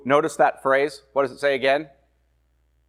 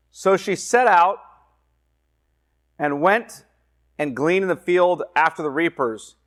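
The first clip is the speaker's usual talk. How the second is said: scripture reading; 4 semitones higher than usual. Acted. Preacher reading Ruth aloud to the class. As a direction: read the verse out to the class.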